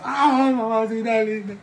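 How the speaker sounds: put-on high, slow wheedling child's voice; pleading child. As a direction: plead sweetly like a scared kid.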